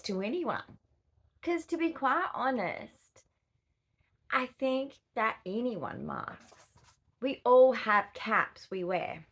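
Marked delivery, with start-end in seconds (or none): none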